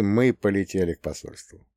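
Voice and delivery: usual speaking voice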